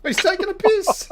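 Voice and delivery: high, loud protest; indignant outcry. Real, not acted. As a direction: cry foul at the game. Protesting opponent's move in game.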